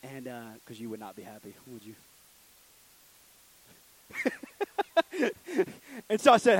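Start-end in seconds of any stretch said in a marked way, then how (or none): 4.1-6.0 laugh. Real, not acted. Speaker laughs at own joke.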